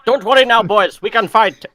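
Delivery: put-on jokey Indian accent, loud and high; Fernando Alonso in Indian accent. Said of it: "Did you just do an Indian accent for Fernando Alonso?".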